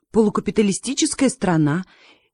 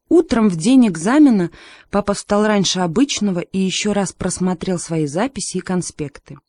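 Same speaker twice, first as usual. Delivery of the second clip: ordinary delivery, nothing marked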